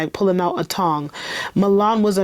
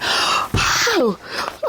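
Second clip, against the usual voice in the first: sudden shocked gasp; sharp gasp; 7 semitones higher than usual. Real, not acted. Reacts to shooting.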